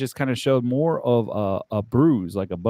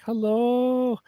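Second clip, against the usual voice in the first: high, drawn-out "hello"; mock hello; 9 semitones higher than usual. Mimicking his tweets after the deletions, mock high hello. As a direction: put on a high, lingering greeting. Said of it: "now it's all like".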